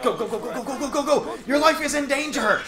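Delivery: high, hammy urging; urgent hurry. Pretend. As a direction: rush your partner along.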